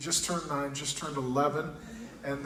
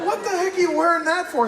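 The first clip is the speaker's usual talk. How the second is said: incredulous retort; 11 semitones higher, 6 dB louder than usual. Retold or imitated. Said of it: "I'm like".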